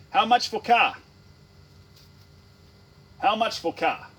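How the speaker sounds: loud, high demand at a salesman; mock shouting customer; blunt customer shout. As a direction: shout it bluntly across the car yard. Acts out a blunt car buyer.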